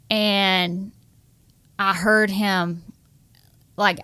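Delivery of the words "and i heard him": usual speaking voice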